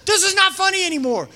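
loud high, stress on not; stern shout. Retold or imitated. Leader stopping the game, stern loud scolding. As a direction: shut the game down firmly. Ordering kids to stop.

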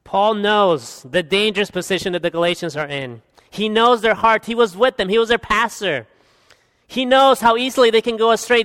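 normal talking voice

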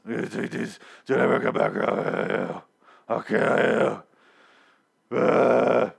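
exaggerated throaty croak, breaking into a growl; hoarse Daryl. Daryl warns Dwight never to return, in a hammed-up hoarse rasp. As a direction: rasp the threat out haltingly as if the throat is shot. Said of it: "Do you want a lozenge?".

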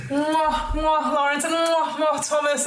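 high mwah-mwah air kisses. Acted. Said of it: "And she goes".

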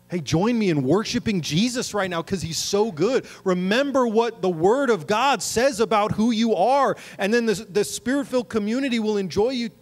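loud, high, driving sermon delivery; impassioned preaching. Acted. Preacher urges the church to worship and be grateful, full-force exhortation.

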